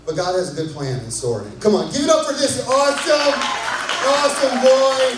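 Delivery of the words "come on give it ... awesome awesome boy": loud, high crowd hype, calling for applause; hype shout. Real, not acted. Hyping crowd to applaud boy.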